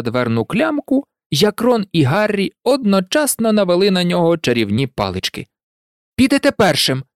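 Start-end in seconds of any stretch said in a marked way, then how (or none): 6.2-7.0 raised-pitch command, restrained shout; mild narrated shout; shouted order. A narrator's reading. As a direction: order him firmly, pitched up. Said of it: "крикнув Рон".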